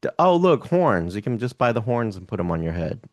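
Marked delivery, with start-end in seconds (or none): none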